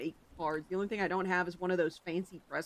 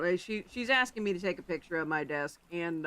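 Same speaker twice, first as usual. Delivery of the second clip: ordinary talk, nothing marked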